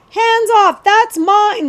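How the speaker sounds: high, loud, outraged claim; snatch it back with your voice; crying out. Acted. The prince cries out at the thieves, high and loud. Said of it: "the prince cried".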